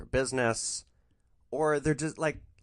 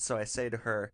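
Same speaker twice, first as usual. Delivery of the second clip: ordinary talk, nothing marked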